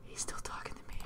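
hushed aside; soft puzzled whisper. Real, not acted. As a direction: whisper it so he won't hear.

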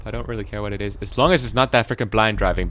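usual speaking voice

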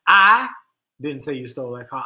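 loud I, a pause, then the rest low; stress on I. Shown as a demonstration. Class demo of how stress changes meaning, stress on I. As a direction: hit "I" hard, pause, drop away on the rest. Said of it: "put the inflection on a different word".

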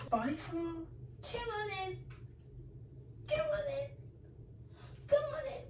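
very high, soft coaxing pet voice; high, soft sing-song call to a pet. Real, not acted. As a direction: coax the dog in sweetly.

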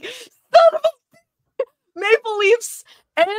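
high, loud, slow laughing curse; mock frustrated outburst. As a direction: vent at both rival teams winning.

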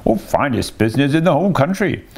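breezy, high washerwoman boast; airy. A narrator's reading. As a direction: toss it off airily in the disguise voice. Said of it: "said Toad airily".

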